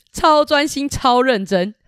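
put-on high, laughing answer to the kids; playful laugh through the words; laughing exclaim. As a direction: burst out with a giggle. Narrator's laughing emphatic answer.

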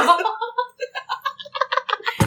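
高音调的大笑; 忍不住放声大笑; high burst of laughter. Real, not acted. 想到自己先晕倒大笑. (高声大笑)

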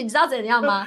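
ordinary talk, nothing marked

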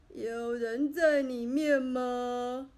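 put-on old man. A narrator's reading. Old man peers into the pit and asks, slow old voice. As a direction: ask into the hole like a slow old gentleman.

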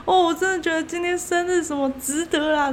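put-on high, hearty drunk toast; imitating a jolly drunk neighbour; tipsy big brother. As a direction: play a boozy stranger. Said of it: "就说".

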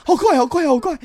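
high, loud rush of fright; excited. Retold or imitated. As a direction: squeal at the speed. Retelling scary fast ride.

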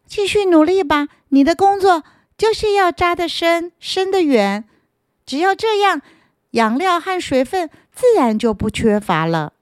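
high, cheering big-brother voice; encouraging earthworm. Acted. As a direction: urge him on kindly.

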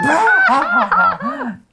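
loud high shriek of laughter. Shown as a demonstration. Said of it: "shrieking and shouting".

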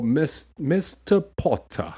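slow, drawn-out British MISTER POTTER; Snape. Retold or imitated. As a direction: imitate a stern professor, r dropped. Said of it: "Professor Snape".

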